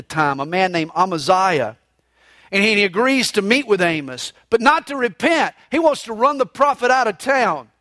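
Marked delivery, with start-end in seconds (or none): none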